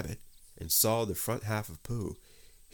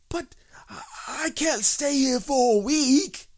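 dismayed wail; 9 dB louder than usual. Acted. Pooh hears he is stuck a week, dismayed wail.

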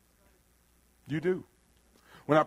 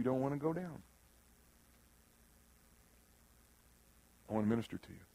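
quietly reach out to the hurting; tender ministering; 3 semitones lower, 7 dB quieter than usual. Real, not acted. Preacher gently inviting the injured to be ministered to, tender.

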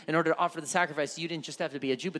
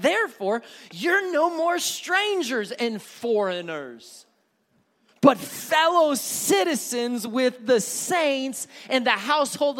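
shouted preaching at the climax, much higher; full-force preaching; 10 semitones higher, 8 dB louder than usual. Acted. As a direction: drive it home like a preacher at the peak.

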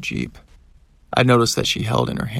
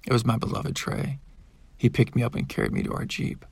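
ordinary delivery

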